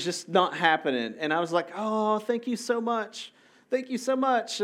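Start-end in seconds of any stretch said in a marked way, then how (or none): 1.8-4.6 high, slow, overdone gratitude; sugary fake thank-you, pitched up and drawn out; fake gushing thanks. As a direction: gush thanks you don't mean. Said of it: "I have to lie my way through thanking them".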